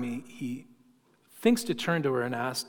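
normal talking voice

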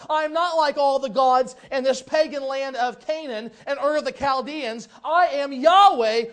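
loud, commanding divine proclamation; booming voice of the Lord; voice of God. Acted. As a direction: declare it as God calling Abraham. Preacher voices God to Abraham.

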